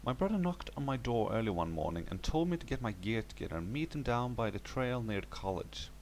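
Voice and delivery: ordinary delivery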